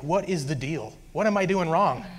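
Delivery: desperate, fed-up appeal retold; exasperated pleading. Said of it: "I was just pleading to Jesus".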